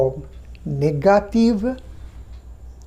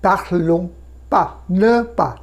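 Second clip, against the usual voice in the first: word-by-word drill; much slower than usual. Shown as a demonstration. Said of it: "আবার দেখুন প্লুরালের দিকে".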